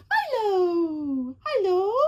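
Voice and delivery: high, loud cooing at the cat; pet greeting. Real, not acted. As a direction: sing out his name to the pet.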